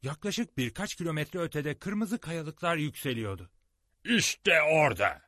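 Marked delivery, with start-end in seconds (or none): 4.0-5.2 high, loud and slow; triumphant shout. A narrator's reading. Challenger spotting the red cliffs, loud triumphant shout. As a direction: call out in triumph. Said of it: "diye bağırdı Challenger".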